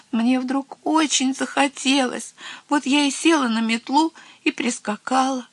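high-pitched, timid little voice; frightened. A narrator's reading. Little witch excusing herself to the head witch, frightened. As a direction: confess in a small high voice. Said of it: "тряслась от страха".

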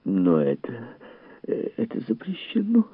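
hesitant, climbing high lady voice; understated high-pitched lament; plaintive wail. A narrator's reading. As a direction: falter and whimper a protest. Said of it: "жалобно возопила миссис Бауэллс".

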